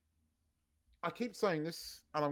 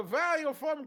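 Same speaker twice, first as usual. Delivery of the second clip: mock German accent, pitched high; 8 semitones higher, slower than usual. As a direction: quote it in an imitated German accent. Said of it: "It was done in a German accent".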